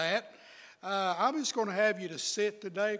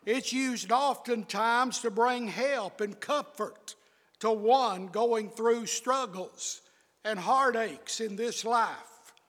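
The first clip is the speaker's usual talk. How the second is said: ordinary delivery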